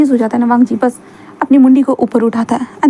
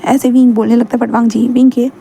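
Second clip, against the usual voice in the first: ordinary delivery, nothing marked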